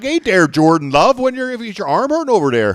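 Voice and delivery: high, loud hammed-up Wisconsin voice; sing-song Wisconsin coach calling out; mock Wisconsin accent. Podcaster imitating a coach asking Jordan Love, Wisconsin accent. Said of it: "went back and forth with Wisconsin accents".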